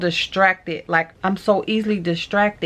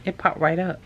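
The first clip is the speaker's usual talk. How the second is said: ordinary talk, nothing marked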